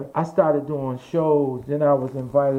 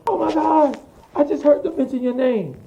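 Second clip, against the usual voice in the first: high, excited retold outburst; going crazy; 11 semitones higher than usual. Aunt phoning late at night with big news, going crazy. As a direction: imitate an aunt freaking out, voice shooting up. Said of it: "going crazy".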